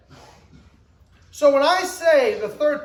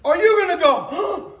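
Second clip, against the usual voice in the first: normal talking voice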